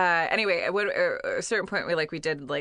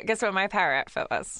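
ordinary talk, nothing marked

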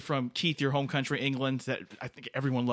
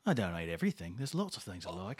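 gruff rock star; 6 semitones lower, 6 dB quieter than usual. Acted.

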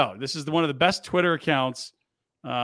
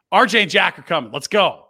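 hype call; 3 semitones higher, 8 dB louder than usual. Real, not acted. Hyping the guests arriving.

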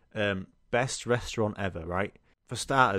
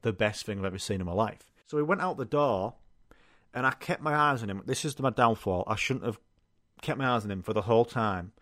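ordinary talk

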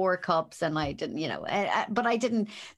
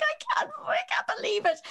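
much higher, hammed-up cry of disbelief; mock outraged mother. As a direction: squeal it like an offended mum. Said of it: "she's like".

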